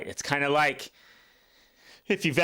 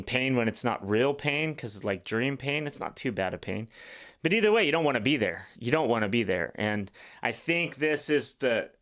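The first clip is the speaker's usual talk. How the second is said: ordinary talk, nothing marked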